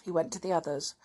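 ordinary delivery, nothing marked

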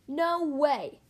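mild narrated shout of refusal. A narrator's reading. Lightning refuses Mater's gravel pit plan, mildly shouting. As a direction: refuse with a firm, contained shout. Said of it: "shouted Lightning".

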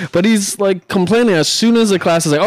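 ordinary talk, nothing marked

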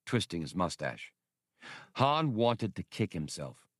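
usual speaking voice

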